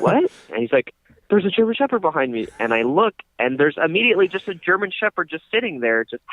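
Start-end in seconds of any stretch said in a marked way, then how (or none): 0.0-0.3 high startled what; surprised. Retold or imitated. Woken, confused by the claim. 1.3-2.5 higher, fast frantic repeat. Retold or imitated.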